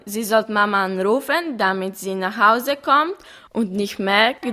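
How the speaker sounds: ordinary delivery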